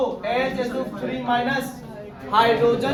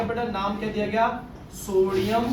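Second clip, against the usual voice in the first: usual speaking voice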